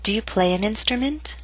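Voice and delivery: "Do you play an instrument?" rising at the end; rising intonation. Shown as a demonstration. Teacher reads question to provide a model, rising intonation. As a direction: lift the pitch through "instrument". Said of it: "I'll read it to provide a model".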